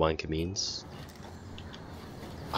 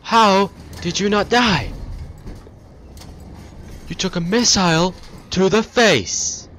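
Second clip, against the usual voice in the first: high, loud disbelieving yell; outraged shout; 11 semitones higher, 6 dB louder than usual. Real, not acted. Gamer yelling at an enemy who survived a missile, outraged shout.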